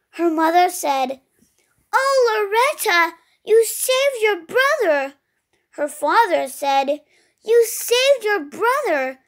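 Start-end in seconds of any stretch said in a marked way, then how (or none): 1.9-5.1 high, lightly acted gushing praise; a touch of motherly relief, pitched up; delighted mother. A narrator's reading. Mother praises daughter in story. 7.4-9.2 high, lightly acted amazed praise; a touch of fatherly amazement, pitched up; delighted father. A narrator's reading. Father praises daughter in story.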